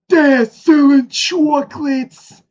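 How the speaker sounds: very high, slow squeaky cartoon voice; SpongeBob cartoon voice. Retelling the SpongeBob chocolate scene, high squeaky cartoon. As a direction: squeak it out slowly. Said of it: "SpongeBob episode".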